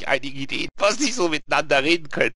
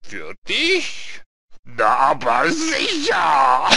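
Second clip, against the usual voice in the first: slow, drawn-out, loud Reaper voice; hammed-up sepulchral punchline; dry Death; 6 semitones higher, 6 dB louder, much slower than usual. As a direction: stretch the answer out as Death. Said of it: "so ganz trocken".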